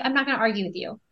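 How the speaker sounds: soft and gentle, imitating dad; loving calm tone. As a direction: quietly decline to argue. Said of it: "in the most loving, calm tone".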